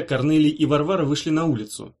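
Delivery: plain reading voice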